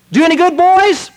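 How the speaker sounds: high, loud shout across the water; loud friendly hail to the fishermen; hearty call. Retold or imitated. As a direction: call out cheerfully to the boat. Jesus calls out to fishermen.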